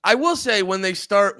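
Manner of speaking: usual speaking voice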